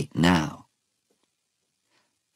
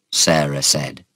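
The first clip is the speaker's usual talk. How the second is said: usual speaking voice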